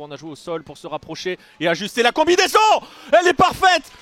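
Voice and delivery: ordinary talk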